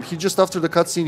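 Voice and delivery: normal talking voice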